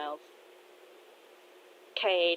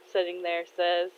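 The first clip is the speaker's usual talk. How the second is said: ordinary delivery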